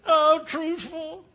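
slow high falsetto complaint; Fanny Victoria's voice. Retold or imitated. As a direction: stretch out the whine in a high old-wife voice. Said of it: "she said".

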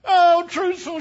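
put-on high falsetto old wife, pleading; Fanny Victoria's voice. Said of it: "she said".

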